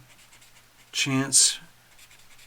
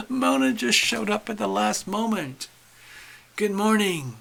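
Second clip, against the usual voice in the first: higher and bright, still excited; delighted greeting; 8 semitones higher, 4 dB louder than usual. Real, not acted. Host welcoming a late viewer, excited and higher. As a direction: keep the welcome bubbling.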